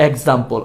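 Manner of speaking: "এক্সাম্পল" starting with e, ek-zampol; wrong pronunciation. Shown as a demonstration. Teacher recaps the mistake for learners, wrong pronunciation. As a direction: say "এক্সাম্পল" with an opening "ek". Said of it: "ভুলটা কি করলাম আমরা".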